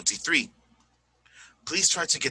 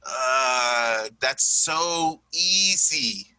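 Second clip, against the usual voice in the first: long drawn-out groan, then very slow words; dismissive student; 3 dB louder, much slower than usual. Acted. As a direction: groan it as if bored by easy work. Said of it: "may see and may go".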